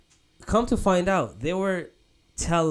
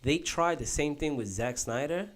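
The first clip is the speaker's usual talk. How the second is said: normal talking voice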